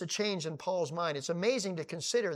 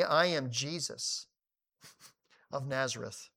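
voice of Jesus; 4 semitones lower, 5 dB quieter than usual. Acted. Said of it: "And he said unto me".

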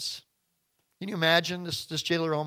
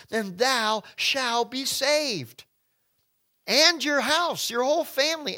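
high, loud, slow preaching; full-force preaching; 7 semitones higher, 3 dB louder, slower than usual. Acted. Preacher proclaiming salvation to the congregation, high and slow. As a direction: proclaim it from the pulpit.

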